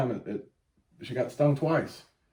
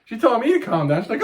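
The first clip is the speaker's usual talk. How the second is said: ordinary talk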